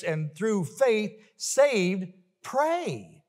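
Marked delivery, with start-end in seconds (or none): none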